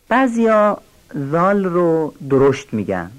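ordinary delivery

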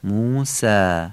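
موسی very slowly, ending on a thin long "aa"; light long alef. Shown as a demonstration. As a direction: draw out a front "sæ" at the end of موسی.

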